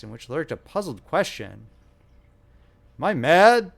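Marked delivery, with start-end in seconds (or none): 3.0-3.8 loud, raised outburst of rage; thundering. Acted. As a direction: fear turning into fury. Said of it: "thundered Mister Button".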